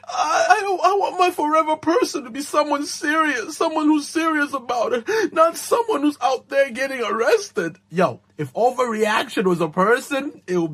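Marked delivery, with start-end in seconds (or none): 0.1-7.8 high-pitched, hammed-up tearful protest; mock crying. Bride upset by husband's arrest joke, mock sobbing. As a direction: wail it like an overwrought bride. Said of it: "She's actually crying, bro".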